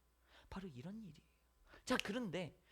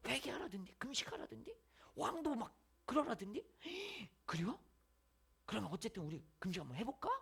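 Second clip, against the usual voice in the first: hushed gossip; 2 semitones higher, 7 dB quieter than usual. Acted. Townspeople passing rumour quietly.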